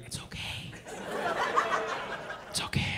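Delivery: near-whispered, mock-soothing aside; very soft, almost breath only; hushed reassurance. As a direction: drop to a soft whisper to comfort them. Soothing the non-laughers.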